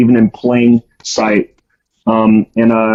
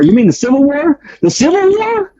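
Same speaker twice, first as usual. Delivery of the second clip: much higher, incredulous double take; you mean THAT war, climbing higher each time; shocked disbelief; 14 semitones higher than usual. Retold or imitated. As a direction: repeat it in stunned disbelief, pitch flying up. Shocked retort to bunkmate.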